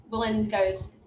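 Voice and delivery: ordinary delivery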